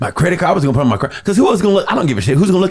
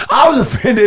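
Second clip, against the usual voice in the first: laughing; 6 semitones higher than usual. Real, not acted.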